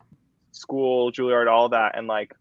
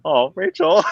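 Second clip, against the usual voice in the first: sympathetic; 7 dB louder than usual. Real, not acted. Friend tears up on air.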